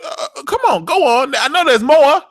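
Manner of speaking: high, loud egging-on; theatrical mock coaxing; taunting goad. Acts out character goading the other.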